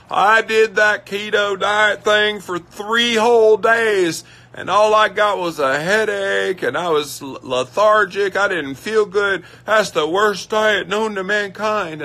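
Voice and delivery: high, loud, slow whining impression of a dieter; mock quitter. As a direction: ham up the complaining dieter. Mocking a dieter who quit keto.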